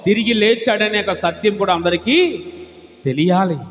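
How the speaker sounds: high, loud pulpit proclamation; fervent preaching. Real, not acted. Pastor proclaiming the resurrection, full-force preaching. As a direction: proclaim it to the whole church.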